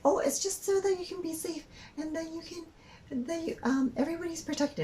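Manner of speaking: high-pitched imitation of a cashier; mock justifying cashier. Cashier explaining safety rules to customer, mock justifying.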